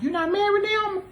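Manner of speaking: high, mock-surprised voice; teasing mock question. She mimics someone asking if he's single again. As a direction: tease as if asking an old flame.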